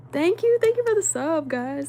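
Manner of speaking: loud, high-pitched gratitude; excited thanks. Real, not acted. Streamer thanking a new subscriber, excited and high.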